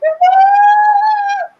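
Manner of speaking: long high-pitched loud "ah"; mock scared cry. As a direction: imitate a frightened guy's drawn-out wail. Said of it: "He was, like, scared".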